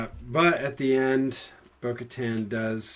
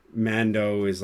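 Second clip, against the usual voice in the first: ordinary delivery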